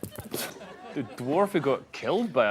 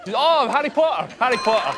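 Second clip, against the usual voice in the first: sudden high shout of recognition; excited shout; 9 semitones higher, 6 dB louder than usual. Real, not acted. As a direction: blurt the answer out loud. Captain guesses the mimed show.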